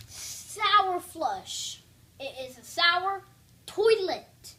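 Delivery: loud announcing; big, loud announcer voice. Real, not acted. Announcing the candy loudly.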